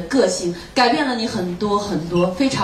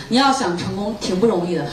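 usual speaking voice

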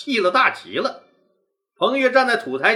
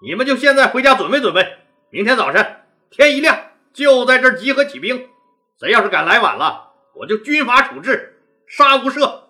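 loud command; 5 semitones higher, 6 dB louder than usual. Acted. Leader issues a harsh order.

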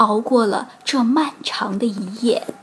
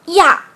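disgusted yelp; 5 semitones higher, 8 dB louder than usual. A narrator's reading. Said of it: "小熊妹妹说".

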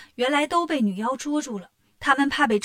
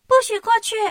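high, loud little creature voice; little insect guards; 10 semitones higher, 5 dB louder, faster than usual. Acted. Tiny insects blocking the brothers on the bridge, high and loud. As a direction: bar the way like a tiny bossy bug.

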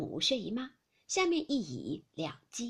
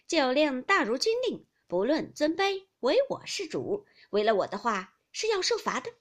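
raised, emphatic mock-military authority; playfully commanding maid; 4 semitones higher, 6 dB louder than usual. A narrator's reading. Maid taking charge of the drinking game, playful command. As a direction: lay down the law, loud and lifted.

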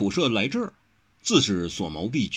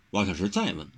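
plain reading voice

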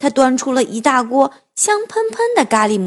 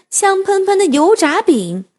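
plain reading voice

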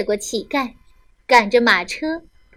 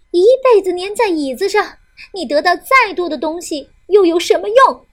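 angry scolding; 5 semitones higher, 5 dB louder than usual. Acted. Wife keeps scolding.

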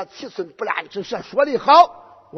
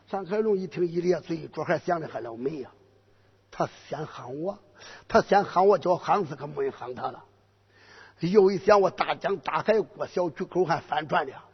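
scheming inner voice; 4 semitones lower, 6 dB quieter than usual. Acted. General's inner thoughts.

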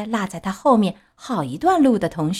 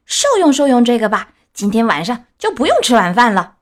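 loud, vicious threat; fierce shout; 3 semitones higher, 7 dB louder than usual. Acted. Fiercest boy threatens Pinocchio, loud and vicious. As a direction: snarl it as you swing. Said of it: "最凶的一个叫道".

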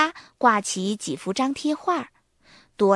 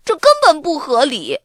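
huffy; 6 semitones higher, 7 dB louder than usual. A narrator's reading. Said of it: "亚瑟气哼哼地说".

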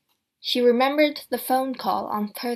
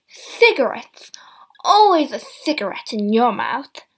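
scolding; 8 dB louder than usual. A narrator's reading. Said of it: "scolding smoker".

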